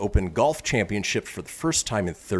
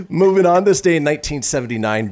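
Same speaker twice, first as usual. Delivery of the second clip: loud, higher show-host segue; announcer read; 6 semitones higher, 6 dB louder than usual. Acted. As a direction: announce the next item big. Segment announcement.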